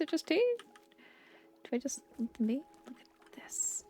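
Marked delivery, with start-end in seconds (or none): none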